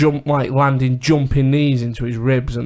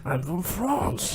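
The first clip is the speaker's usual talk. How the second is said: put-on French accent; 4 semitones higher, 10 dB quieter, much slower than usual. Continuing French accent bit.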